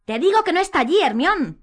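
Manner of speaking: loud, high angry insistence; exasperated shout. A narrator's reading. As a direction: yell it in frustration. Harry exasperated, contradicts Hermione.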